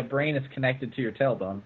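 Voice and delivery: ordinary delivery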